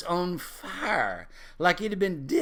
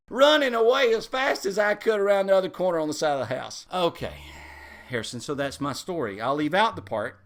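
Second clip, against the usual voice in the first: plain reading voice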